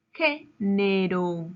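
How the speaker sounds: Género slowly, GÉ set apart; slow teacher's Género, weight on first syllable; stress on GÉ. Shown as a demonstration. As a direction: punch GÉ, pause, then draw out nero. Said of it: "lleva su mayor fuerza de voz".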